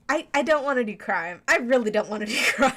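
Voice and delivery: loud, laughing complaint; mock protest. Player balking at in-game theft, loud mock protest. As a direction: protest loudly, breaking into a laugh. Comic protest at having to steal.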